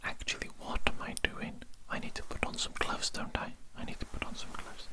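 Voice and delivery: normal talking voice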